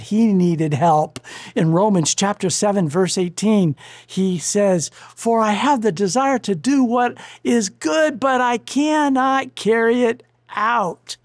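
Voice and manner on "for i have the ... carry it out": high, emphatic Bible reading; preacher quoting scripture, slowing at the end; scripture quotation. Acted. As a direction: declaim the verse with raised pitch. Quotes a Bible verse aloud.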